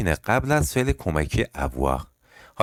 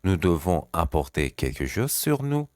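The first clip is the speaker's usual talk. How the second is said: slow repeat; much slower than usual. Shown as a demonstration.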